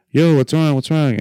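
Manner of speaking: mock calling out "yo". Retold shout at TSA agent.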